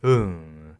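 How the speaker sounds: put-on low, drawn-out drunken drone; mumbled drunken noise; drunk person. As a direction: mimic a drunk reading lines. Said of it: "he sounds like a drunk person".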